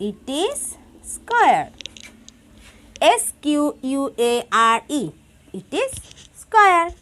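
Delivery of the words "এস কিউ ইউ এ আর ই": "এস কিউ ইউ এ আর ই" rattled off letter by letter, fast; spelling aloud. Shown as a demonstration. Teacher spelling "square" for the class. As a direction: spell it out quickly. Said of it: "spelling out square".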